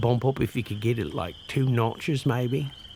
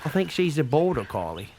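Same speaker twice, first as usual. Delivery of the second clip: put-on Australian accent; 3 dB quieter than usual. Put-on Aussie accent throughout.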